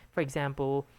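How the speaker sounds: ordinary talk, nothing marked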